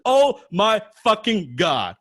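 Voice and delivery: high, slow, loud exclamation; loud excited yell. Retold or imitated. Fan too loud at the back of a workshop, high slow yell. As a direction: let it ring out across the room. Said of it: "annoyed because I was so loud".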